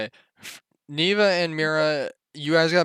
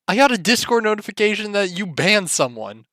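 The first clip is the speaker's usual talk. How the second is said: ordinary talk, nothing marked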